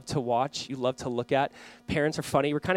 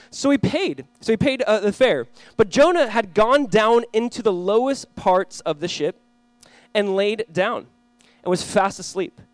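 slow, raised, solemn Bible reading; scripture reading; 4 semitones higher, 5 dB louder, slower than usual. Acted. Pastor reading Jonah to the congregation, slow and solemn. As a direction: read it out to the church with weight.

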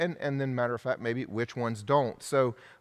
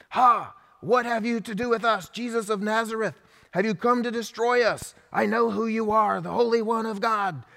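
bad demon impersonation; 8 semitones higher than usual. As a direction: ham up a screeching demon, badly on purpose. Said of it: "That's a really bad demon impersonation".

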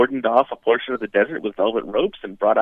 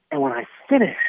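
ordinary talk, nothing marked

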